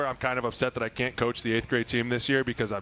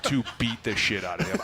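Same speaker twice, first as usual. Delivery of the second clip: usual speaking voice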